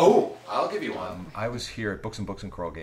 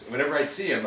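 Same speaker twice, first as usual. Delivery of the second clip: ordinary delivery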